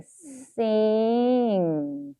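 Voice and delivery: "sing" very long and drawn out; sounding out while writing. Shown as a demonstration. Teacher writing the word, stretching "sing" out slowly. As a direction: hold the vowel and ng of "sing". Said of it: "발음하시면서 씁니다".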